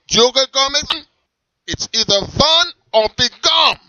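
high, loud strict German character; German accent. Acted. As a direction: swagger in a thick German accent.

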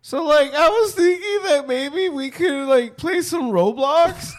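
put-on very high, loud cracking kid voice; squeaky excited kid. Asking the date to play Roblox, squeaky kid voice. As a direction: burst into a shrieky child's pitch.

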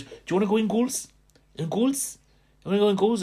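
high, fast Valleys Welsh question; imitating the Valleys boys' Welsh lilt; Welsh Valleys accent. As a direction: urge him into goal in a lilting Valleys Welsh. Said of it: "a different accent".

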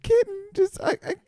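high, hammed-up crying plea; fake-sob like a heartbroken owner; mock sobbing owner. Puma owner pleading with the zoo, mock sobbing high. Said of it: "You can't have my puma".

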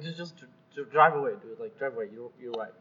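low, soft, fading brush-off; resigned dismissal. Retold or imitated. Telling the raging driver to leave, resigned. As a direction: give up and wave them away quietly. Retold giving up on argument.

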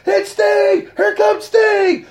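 screaming wrestling commentator call; very high, loud shout, last word stretched out; Jim Ross. Retold or imitated. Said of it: "I can hear Jim Ross scream".

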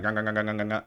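repeated cartoon munching noise; imitated gobbling. Narrator mimics Hitomi gorging herself on snow, mock gobbling. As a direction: gobble in quick nonsense syllables.

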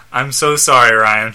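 ordinary delivery, nothing marked

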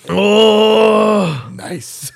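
loud cry at the sour taste; eating sour candy. Real, not acted. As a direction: react to a puckering sour bite. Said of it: "It's so sour".

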